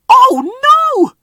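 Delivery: high, loud alarmed shout; alarmed cry. Acted. Driver sees the engine run onto the dangerous track, cries out high. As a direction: yell out in alarm. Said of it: "cried Rheneas's driver".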